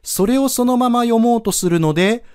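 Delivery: normal talking voice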